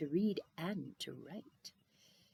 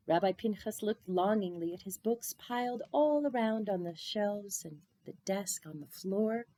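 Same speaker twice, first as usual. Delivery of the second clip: ordinary delivery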